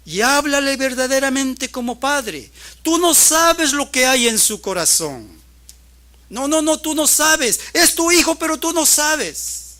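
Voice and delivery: high, shouted exhortation; full-force preaching. Real, not acted. Preacher confronting parents about their children's hearts, full-force preaching. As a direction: drive it home at full volume.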